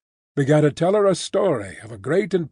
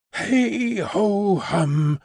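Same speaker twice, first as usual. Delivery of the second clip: slow, cheerful cover of a sigh; mild sighing hum; sigh held back; 5 semitones higher, much slower than usual. A narrator's reading. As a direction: hum it slowly, hiding a sigh. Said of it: "cheerfully repressing a sigh".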